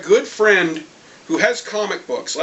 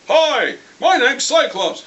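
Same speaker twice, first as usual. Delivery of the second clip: higher, slow, put-on hero voice; mock Cyclops voice; 6 semitones higher, 3 dB louder, slower than usual. Cyclops greeting the school kids, hammed-up hero voice. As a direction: introduce yourself like a cheesy hero.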